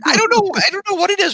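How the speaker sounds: very high-pitched comic squeal; mock bewilderment. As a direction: squeal in hammed-up bafflement. Comic bafflement at Joker's bite.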